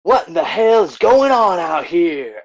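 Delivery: high, slow booming demand; Swift's booming voice. Acted. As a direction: boom over the chaos as Swift. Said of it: "how would Swift respond to this".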